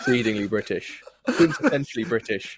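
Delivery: laughing through the words; chuckling while piling on the joke. Real, not acted. Host joking with guest about Britishness, laughing.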